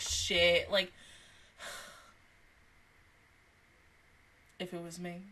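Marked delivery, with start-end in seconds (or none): 4.6-5.2 very soft, low and sighed; quiet aside. Real, not acted. Imagining herself facing nosy coworkers, quiet aside. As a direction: murmur it low after a sigh. First take, before laughing repeat.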